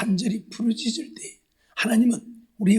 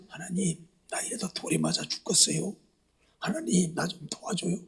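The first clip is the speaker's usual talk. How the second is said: low, hushed mock prayer; acted meek little murmur to God; quiet, timid pleading; 4 semitones lower, 11 dB quieter than usual. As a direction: plead softly, almost under your breath. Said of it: "모세가 조용조용 부르짖었을까요?".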